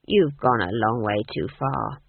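low, measured warning; grave telling-off; stern rebuke. A narrator's reading. Granny sternly rebukes the Duke.

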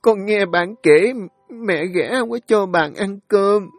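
put-on a little girl begging her mother; crying child. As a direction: daughter pleading at mother's bedside, crying. Child pleads with mother again.

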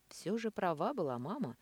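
usual speaking voice

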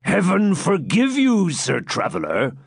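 slow, creaky tipsy friar; a jovial old friar, slightly drunk; creaky old hermit. Acted. Hermit answers the visitor.

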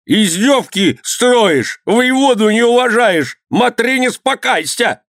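high, drawn-out indignant yell; angry shouting. A narrator's reading. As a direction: rage at him high in pitch, stretching words. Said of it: "топал ногами и кричал". (возмущённый протяжный крик)

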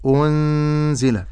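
أنزل drawn out slowly, nun hidden into the z; slow recitation of أنزل with nasal ghunna; ikhfa demo. Shown as a demonstration. Teacher modelling the hidden nun for a student. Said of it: "فأقول".

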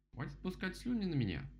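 high-pitched cross rabbit voice; indignant rabbit. A narrator's reading. Rabbit objects to being drooled on, indignant.

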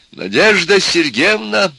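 high, drawn-out entreaty; imploring. A narrator's reading. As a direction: beseech her with a loud, slow plea. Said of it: "опять взмолился профессор".

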